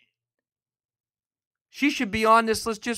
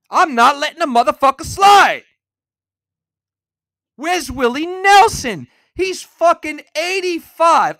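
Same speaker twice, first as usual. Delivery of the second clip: loud, fired-up declaration; angry shout; 6 semitones higher, 8 dB louder than usual. Real, not acted. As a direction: bellow it like a vow. Ranting at the list makers.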